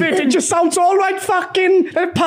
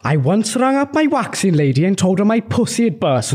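a light Welsh lilt on the retelling; mock Welsh accent; 6 semitones lower than usual. Son in a Welsh-off doing a Welsh accent. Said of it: "We were told to do a Welsh off".

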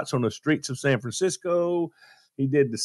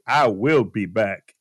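slow, stiff I will be back with every word said; Arnold's way; 5 semitones lower, slower than usual. Shown as a demonstration. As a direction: spell out "I will be back" in Arnold's Austrian accent. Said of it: "He wanted to say".

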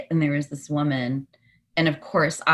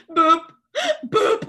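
awkward mock boop; 5 dB louder than usual. Mimics the cashier's awkward reaction.

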